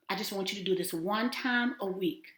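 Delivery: ordinary delivery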